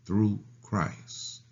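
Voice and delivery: ordinary talk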